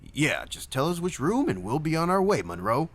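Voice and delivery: high, swooping character voice; chiming-in buddy. A narrator's reading. Zach chiming in to the desk clerk, brash and high. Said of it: "chimed in Zach".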